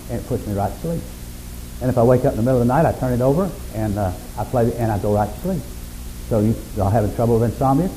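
ordinary talk

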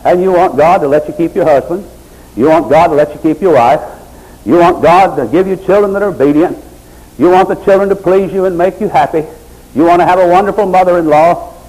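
sermon at full pitch; fiery preaching. Real, not acted. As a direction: preach it hard to the congregation.

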